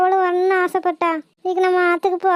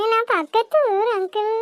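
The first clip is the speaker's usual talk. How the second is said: put-on frightened little girl; 2 semitones higher than usual. Girl answers questions.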